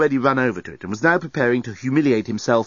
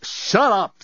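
high, abrupt angry order; sharp command; 11 semitones higher than usual. A narrator's reading. Dan silencing screaming Lucy, sharp command. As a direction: cut off the screaming with a sudden outburst. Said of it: "first time he'd ever spoken to Lucy like that".

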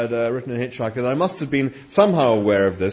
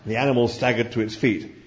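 usual speaking voice

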